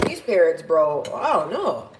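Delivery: ordinary delivery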